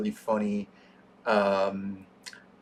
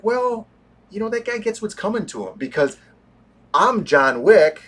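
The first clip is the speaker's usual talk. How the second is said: higher, defiant mock John Wick; 7 semitones higher than usual. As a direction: sneer it like a wronged assassin. Voicing John Wick's defiance.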